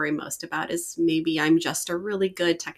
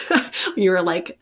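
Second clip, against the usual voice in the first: usual speaking voice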